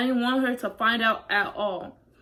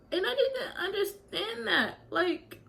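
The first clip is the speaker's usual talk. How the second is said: high, tearful half laugh; crying through the words; real tears, laughing and crying at once; voice breaking; 10 semitones higher, 5 dB quieter, slower than usual. Real, not acted. Breaking while telling of bullying.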